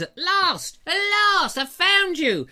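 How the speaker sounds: high, loud, mock-triumphant read; theatrical exclamation. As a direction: cry it out like a long-awaited discovery. Reading a viewer's exclamation aloud.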